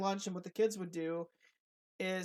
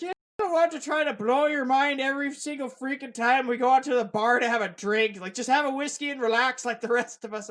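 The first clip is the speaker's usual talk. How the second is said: loud, higher exasperated retelling; Devin; 5 semitones higher, 8 dB louder than usual. Retold or imitated. Friend telling him to relax at the bar, loud mock scolding. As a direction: tell off an overthinker, loud and emphatic. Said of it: "the way my friend Devin used to put it".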